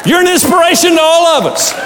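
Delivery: high, shouted tribute; hammed-up cheering praise; mock shouted praise. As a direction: cheer it out like a fan. Preacher quoting praise, shouted.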